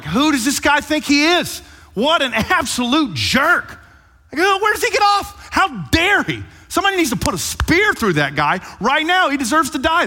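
high, loud outraged ranting; furious yelling. Retold or imitated. David raging at the rich man, high furious yelling. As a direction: rant like a man losing his mind. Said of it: "David is yelling and cussing".

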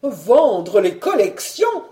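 loud, slow, aghast cry; horrified exclamation. A narrator's reading. Collector aghast at selling his collections, loud drawn-out cry. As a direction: cry out in horror, drawing the words out. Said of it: "s'écria-t-il en verdissant".

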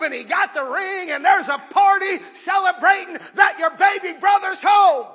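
high, loud joyful announcement; excited shouted news. Acted. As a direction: shout the news that the lost brother is back. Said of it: "They said".

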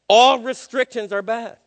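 ALL stretched out loud and slow; booming slow pulpit emphasis; emphatic preaching. Acted. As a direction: hammer every word, rising on ALL. Preacher mocks the serpent's claim.

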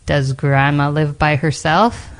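plain reading voice

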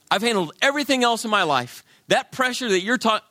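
high, proud bragging; cocky self-assurance. Pretend. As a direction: insist you've handled everything. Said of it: "thinking to yourself".

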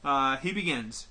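ordinary talk

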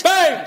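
high, loud mock gunshot; musket bang. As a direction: shout the bang like a toy gun. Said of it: "said the musket".

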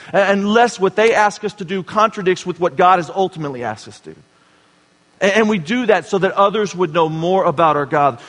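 fervent pulpit appeal; preaching at full force. Acted. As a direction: push the congregation with conviction.

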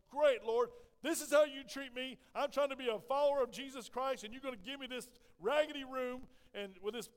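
loud, high, aggrieved protest; upset complaint. Retold or imitated. Teacher complains to the Lord about his raggedy room, upset. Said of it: "I was just so upset".

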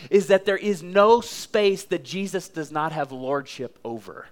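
ordinary delivery

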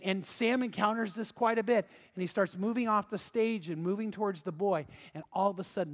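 ordinary talk, nothing marked

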